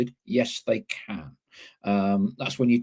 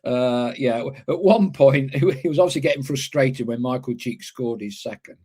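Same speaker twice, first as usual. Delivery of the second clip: ordinary talk, nothing marked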